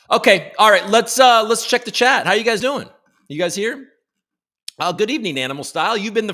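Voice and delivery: loud upbeat hello to chat; energetic greeting. Real, not acted. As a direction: hype up the chat as the stream opens.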